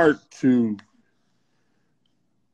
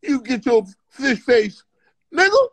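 Richard Pryor; 10 semitones higher than usual. Retold or imitated. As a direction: drag out the comic insult, pitched high. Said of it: "Richard Pryor where he be cussing".

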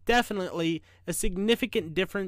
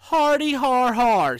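slow, loud, sarcastic har-har; higher, sceptical engineer voice; mocking fake laugh; 5 semitones higher, 8 dB louder, slower than usual. A narrator's reading. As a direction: scoff with a drawn-out mock laugh. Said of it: "biggest, sternest voice".

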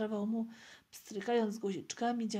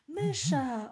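higher, louder blurted guess; eager child's guess; 5 semitones higher, 7 dB louder than usual. Acted. Child excitedly guesses parcel's content. (dziecięce, głośne zgadywanie)

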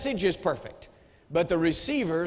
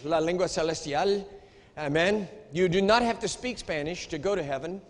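normal talking voice

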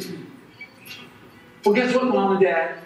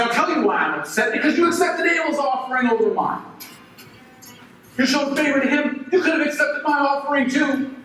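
high, raised and resentful; angry confrontation; 6 semitones higher than usual. Acted. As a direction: demand an answer from God. Cain confronts God.

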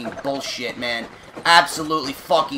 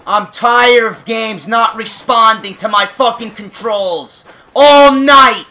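yelling in real frustration at the game; furious shout; 7 semitones higher, 10 dB louder, slower than usual. Real, not acted. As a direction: scream it out, fed up and furious. Raging at unresponsive game.

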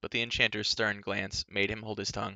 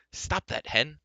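raised-pitch scolding call; mild cry; 6 semitones higher, slower than usual. Acted. Youth crying out to his wallowing pig, mildly exasperated. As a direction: call to a misbehaving animal. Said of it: "Taran cried".

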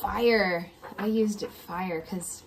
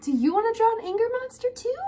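excited; 11 semitones higher, 5 dB louder, much faster than usual. Real, not acted. Eager invite to draw together.

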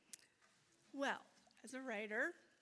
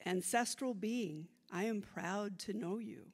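measured, louder poem recital; poetry reading; 5 dB louder than usual. Acted. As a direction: read it like a poem onstage. Reads her bilingual poem aloud.

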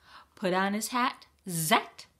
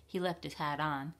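usual speaking voice

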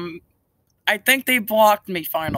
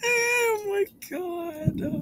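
exasperated cry; 12 semitones higher, 6 dB quieter, much slower than usual. Real, not acted. Exasperated at being hung up.

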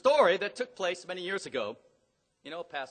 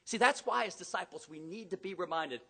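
normal talking voice

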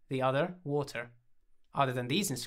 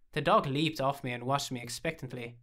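ordinary delivery, nothing marked

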